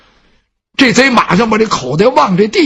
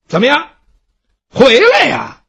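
calling out; 5 semitones higher than usual. Acted. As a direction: call him back. Calling the thief back.

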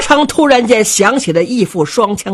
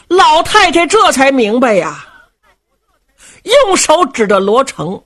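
ordinary delivery

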